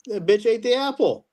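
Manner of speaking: ordinary talk, nothing marked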